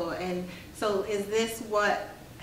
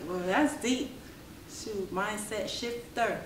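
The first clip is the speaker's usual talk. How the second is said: put-on Jamaican accent; 4 dB quieter, slower than usual. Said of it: "In my Jamaican accent".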